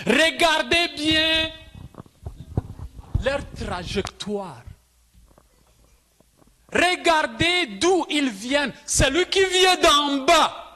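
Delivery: high, emphatic preacher's delivery with long pauses; forceful preaching. Real, not acted. Preaches to congregation in French. (prédication énergique, voix haute)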